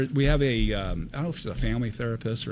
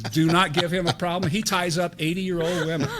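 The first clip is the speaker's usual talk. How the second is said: louder, higher teasing warning, laugh creeping in; mock warning; 6 semitones higher, 4 dB louder than usual. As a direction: warn everyone in jest. First officer's mock warning to crew.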